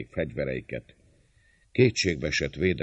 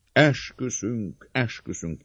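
crowd's oath; 8 semitones higher, 5 dB louder, slower than usual. A narrator's reading. Mob repeating the oath to defenders, calling out. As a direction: chant the vow like a crowd. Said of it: "ismétli a tömeg".

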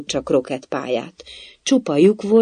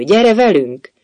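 high, loud summons; imperious raised-voice call; bellowed command; 6 semitones higher, 7 dB louder, slower than usual. A narrator's reading. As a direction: order someone along loudly. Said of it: "üvöltötte a királynő".

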